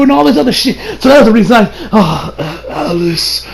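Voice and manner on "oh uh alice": very soft, faint and slow last words; dying whisper to Alice; weak dying voice. Retold or imitated. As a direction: fade out like a man breathing his last.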